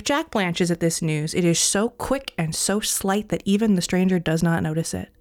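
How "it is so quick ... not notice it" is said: plain reading voice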